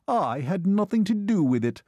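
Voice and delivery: high-pitched indignant denial; snapped retort. A narrator's reading. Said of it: "his son snapped in return".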